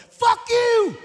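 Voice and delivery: very high, loud shout; imitated defiant yell. Son yelling at dad from downstairs, imitated shout. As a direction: yell it as if from another floor.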